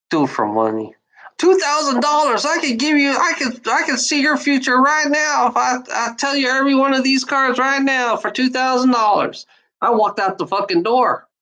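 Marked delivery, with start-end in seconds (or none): 1.4-9.4 higher pushy sales voice; mock tarot reader upping the price; greedy psychic. Imitating the psychic demanding more money.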